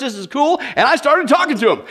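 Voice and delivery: normal talking voice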